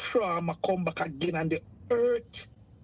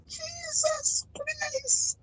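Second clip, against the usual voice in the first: a long, drawn-out wail, much higher; anguished cry; much slower than usual. Real, not acted. Caller reliving her abuse on air, a slow, high anguished wail. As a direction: cry it out slowly in real pain.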